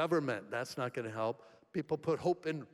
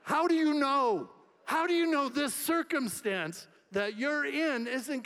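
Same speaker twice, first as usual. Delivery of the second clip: much higher, loud impassioned cry; preaching shout; 10 semitones higher, 6 dB louder than usual. Acted. Pastor challenging the church, shouting with passion. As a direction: hurl the question at the congregation. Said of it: "preaching climax".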